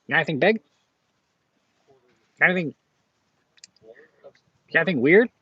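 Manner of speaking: a light jab at the pushy buyer; imitating the comic guy. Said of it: "He says that every damn time".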